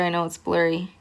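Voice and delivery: ordinary talk, nothing marked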